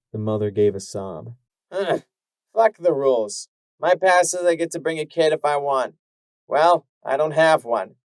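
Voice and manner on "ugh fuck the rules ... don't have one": loud, higher, blunt and rough; harsh. Acted. Mare dismissing the guard's rules, harsh and loud. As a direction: brush off the rules with contempt. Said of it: "Her harsh tone".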